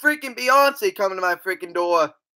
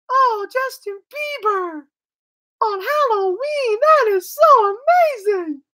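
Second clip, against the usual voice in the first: gushing fangirl; 14 semitones higher than usual. Retold or imitated. Mocking excited [name] fans.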